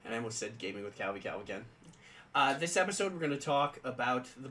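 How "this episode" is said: ordinary talk, nothing marked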